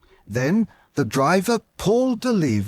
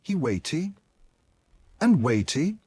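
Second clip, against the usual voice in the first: ordinary delivery, nothing marked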